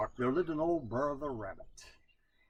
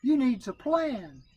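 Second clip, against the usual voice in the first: high, slow, knowing advice; Brer Rabbit voice; 11 semitones higher, 5 dB louder, slower than usual. A narrator's reading. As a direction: advise him slyly. Rabbit advising.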